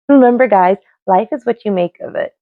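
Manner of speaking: ordinary delivery, nothing marked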